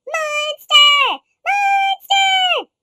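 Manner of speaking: high, loud calling shout; cheering call. Acted. Little monsters calling after Monster, cheering shout. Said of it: "they cheered after him".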